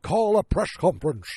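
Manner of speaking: Richard Nixon. Retold or imitated. President deciding after Mentallo's advice, loud slushy caricature. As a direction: proclaim it with s slurred into sh. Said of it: "a Richard Nixon impersonation".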